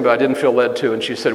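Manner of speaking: ordinary talk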